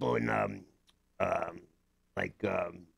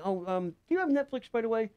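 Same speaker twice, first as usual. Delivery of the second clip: much higher, coy question to a stranger; mock sweet voice; 13 semitones higher than usual. Asking a cashier about Netflix, coy high voice. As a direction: play innocent and sweet. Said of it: "I'll say".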